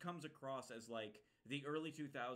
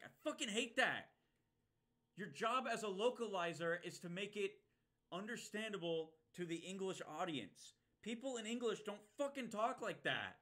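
loud, higher, heated complaint; angry rant; 7 semitones higher, 7 dB louder than usual. Real, not acted. Streamer ranting to chat about dubs, loud and angry. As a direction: vent at anime localizers, loud.

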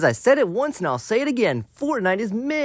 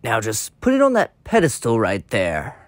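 deep villain voice; 6 semitones lower than usual. Acted. Eggman villain voice.

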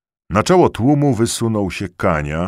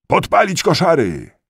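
loud, high-pitched incitement; rioter's shout; 12 semitones higher, 5 dB louder than usual. A narrator's reading. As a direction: incite a mob at full voice. Riotous crowd calls for arson. (wysoki, podburzający okrzyk)

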